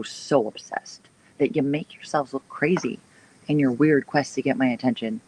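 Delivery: normal talking voice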